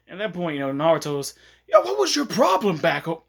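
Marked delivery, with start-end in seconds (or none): 1.7-3.2 high, loud confrontational call-out; raised-pitch indignant shout; Naruto yelling. Retold or imitated. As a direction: kinda yell it across the room. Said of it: "Naruto's actually kinda yelling at him".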